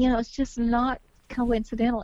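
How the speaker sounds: usual speaking voice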